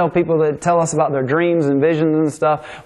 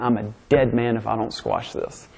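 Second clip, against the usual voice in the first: softer, lower, said as if to himself; inner thought; 6 semitones lower, 6 dB quieter than usual. Retold or imitated. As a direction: mutter the fear inside his head. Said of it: "he's thinking in his mind".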